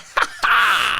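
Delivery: high, sharp mock laugh; put-on burst of high laughter; imitated guy laugh. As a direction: imitate a hooded guy's cackle. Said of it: "They're like".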